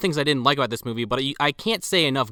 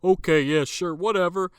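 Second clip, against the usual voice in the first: higher-pitched mock humouring; dismissive brush-off; 7 semitones higher than usual. Imitating two cops humouring the detective, dismissive. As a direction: brush them off with a scoffing lilt. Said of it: "And they're just like".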